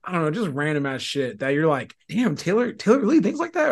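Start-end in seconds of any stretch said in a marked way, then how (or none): 2.1-3.7 higher-pitched mock amazement; imitated listener surprise. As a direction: play an amazed fan, voice lifting high. Imagined listener amazed at Taylor.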